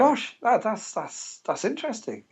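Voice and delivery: hide your shock behind politeness; startled but keeping it polite; mild mock surprise. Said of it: "I thought".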